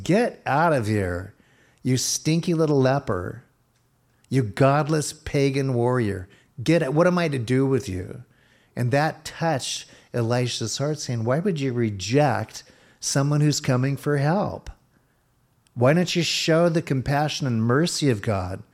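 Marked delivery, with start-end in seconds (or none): 0.0-8.2 angry king. Retold or imitated. King of Israel throwing out the leper, angry. 11.2-18.6 raised, urgent appeal; impassioned plea. Retold or imitated. Elisha pleading for compassion, impassioned.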